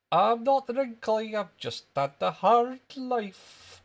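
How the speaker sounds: put-on loud, high, drawn-out Scottish boy; indignant little Scottish schoolboy, very high; Jimmy Krankie. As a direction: protest in a slow Scottish squeal. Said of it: "That was wee Jimmy Cranky".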